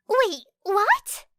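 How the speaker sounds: high startled squeak, rising; a surprised little squeak. A narrator's reading. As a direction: jump up in pitch like a startled girl. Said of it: "squeaked Uraraka".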